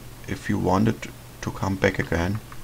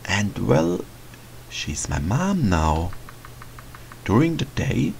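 softer, quieter inner voice reading a diary; diary narration; 3 semitones lower, 4 dB quieter than usual. Acted. The boy reads his diary entry quietly to himself. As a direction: read it like a private journal entry.

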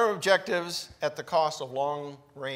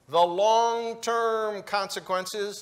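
"The long-term consequences" slow, with long-term drawn out and louder; stress on long-term; 3 semitones higher, 4 dB louder, much slower than usual. Shown as a demonstration. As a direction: lean hard on "long-term", slowly. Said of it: "put the emphasis on the right syllable".

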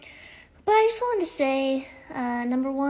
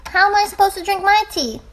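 loud exasperated; 6 semitones higher, 10 dB louder, faster than usual. Real, not acted. Loud complaint at interruption.

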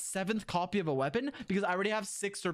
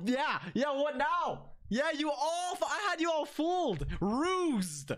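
ordinary talk

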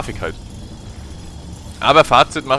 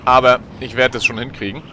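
ordinary talk

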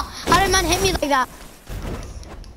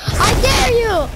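higher, louder and slow, stretching the "dare"; taunting dare; 6 semitones higher, 3 dB louder, slower than usual. Real, not acted. Gamer goading an enemy to attack, slow loud taunt. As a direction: goad them, drawing the dare out.

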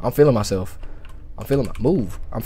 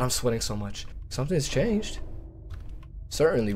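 ordinary talk, nothing marked

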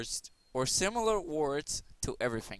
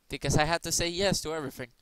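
usual speaking voice

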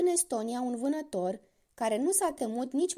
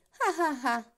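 another mocking devil laugh; spiteful laugh; 4 semitones higher than usual. A narrator's reading. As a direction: laugh at him with malice. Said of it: "râse diavolul cu răutate". (râs batjocoritor)

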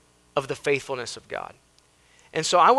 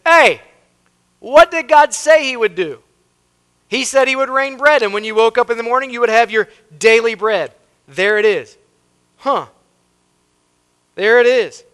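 loud, high-pitched insistent reminder; Moses calling out; 9 semitones higher, 11 dB louder than usual. Acted. Moses reminding Israelites of God's promise, loud calling out. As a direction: call out to a doubting crowd. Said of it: "And Moses said".

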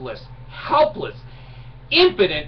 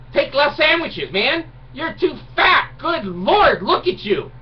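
mocking insult; 8 semitones higher, 4 dB louder, slower than usual. Insulting the man at the pool.

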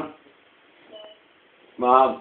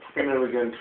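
ordinary talk, nothing marked

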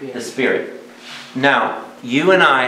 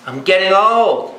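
put-on higher, slow, pleading old man's whine; 6 semitones higher, slower than usual. Preacher repeating Abraham's complaint, higher and more pleading. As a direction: push the second old up into a wail.

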